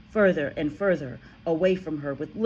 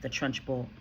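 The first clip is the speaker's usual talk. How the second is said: plain reading voice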